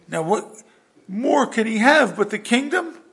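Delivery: high, loud mock-aggrieved voice; whiny complaint. Saul grumbles that only the kingdom is left, whiny complaint. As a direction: protest it like a jealous king. Acting out Saul's jealous complaint.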